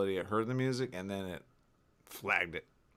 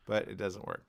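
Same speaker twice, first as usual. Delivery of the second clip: ordinary talk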